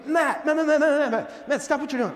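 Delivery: dad calling out. Retold or imitated.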